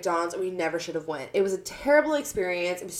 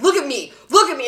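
louder mock bouncer order; imitating bouncer; 6 semitones higher, 8 dB louder, faster than usual. Retelling the bouncer stepping in, mock stern command. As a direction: bark it like a bouncer, hammed up. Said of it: "He's like".

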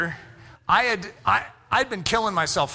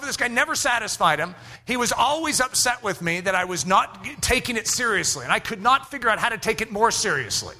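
normal talking voice

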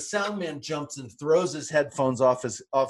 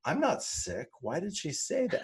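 puzzled; 7 semitones higher, 7 dB quieter than usual. Retold or imitated. Retells own puzzled first reaction.

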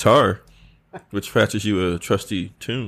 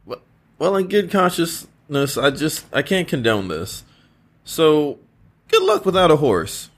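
lightly raised king voice; concerned king, gently urging rest; King Arthur voice; 8 semitones higher than usual. Acted.

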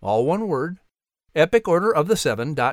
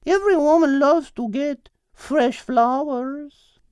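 Isabella's voice; 11 semitones higher than usual. A narrator's reading. Narrator voicing Isabella, high and insistent. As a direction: lean in with a high, bright character voice.

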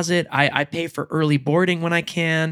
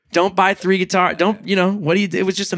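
ordinary delivery

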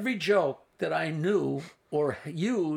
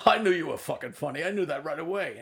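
imitating Rodney. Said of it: "It's Rodney".

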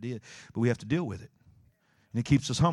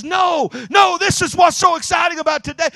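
high loud eager shouting; excited shout; 11 dB louder than usual. Retold or imitated. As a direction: insist with bursting excitement. Phil insisting excitedly.

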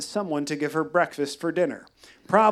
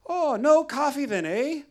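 Irish guide; 10 semitones higher than usual. Retold or imitated. Mike asking, Irish lilt.

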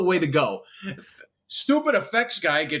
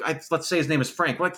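ordinary talk, nothing marked

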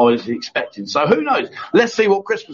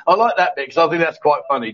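ordinary talk, nothing marked

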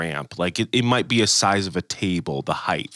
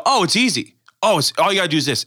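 put-on higher, fast, breezy kid voice; easygoing kids on the ramp coaching an older skater, breezy and fast; kid showing how it's easy; 6 semitones higher, faster than usual. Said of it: "They go".

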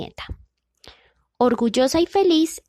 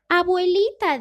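put-on astonished girl; 8 semitones higher, 4 dB louder than usual. A narrator's reading. Niña asombrada.

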